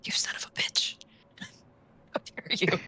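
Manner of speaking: whispered playful curse; mock-outraged whisper. Friend threatens to reveal the prank, mock-outraged whisper.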